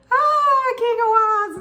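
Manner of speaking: a gleeful high cry at a good card; excited squeal. Real, not acted. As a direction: squeal the card's name with glee. Reacting to the card drawn.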